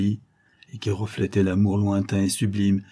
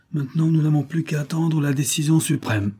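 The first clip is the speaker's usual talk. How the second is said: higher, a faint tremble under the words; slightly trembling voice; 6 semitones higher than usual. A narrator's reading. Judex to his mother awaiting the verdict, slightly trembling. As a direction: hold back the nerves, barely. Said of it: "d'une voix qui tremblait légèrement".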